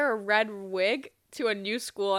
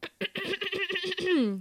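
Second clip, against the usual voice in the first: long, higher-pitched repeated throat clearing; throat-clearing warm-up; 8 semitones higher, 4 dB quieter than usual. Pretend. Host warming up the voice, drawn-out throat clearing. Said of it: "Vocal warm-ups".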